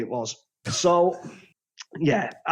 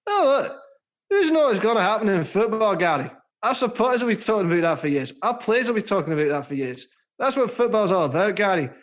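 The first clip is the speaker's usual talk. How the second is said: put-on Glasgow accent; Alex Ferguson; 4 semitones higher than usual. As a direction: play a Scottish football manager in broad Glaswegian. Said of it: "meant to be Alex Ferguson".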